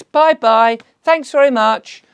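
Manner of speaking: high, loud sing-song goodbye; mock farewell. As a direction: wave them off cheerily. Mocking elites losing grip.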